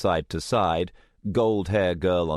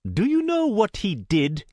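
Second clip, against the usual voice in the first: much higher accusing male voice; accusing young man; 3 dB louder than usual. Acted. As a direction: demand it, accusing. Indian boy challenges them.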